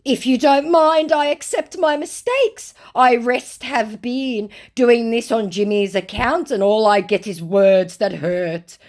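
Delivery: high, loud theatrical wail; hammed-up poor-me complaint; mock self-pitying lament. Scammer laments his treatment, mock self-pity. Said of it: "said our self-pitying, lamenting scammer".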